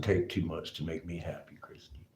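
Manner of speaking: hushed, soft confession; quiet whispered aside; real whisper. Real, not acted. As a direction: whisper it, content and low-key.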